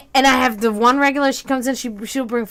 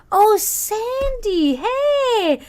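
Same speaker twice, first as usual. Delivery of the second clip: high, drawn-out friendly hello; delighted recognition; 10 semitones higher, much slower than usual. Retold or imitated. Server recognizes her regular on the phone, sweet delighted greeting. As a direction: stretch it out in a sing-song welcome. Acts out recognizing caller Sandy.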